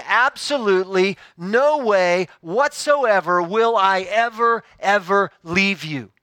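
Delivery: slow, weighted stress on "Absolutely no way whatsoever"; deliberate emphasis, "no way" set apart; emphatic promise. Acted. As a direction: land hard on each "ever", word by word. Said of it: "It's an emphatic promise".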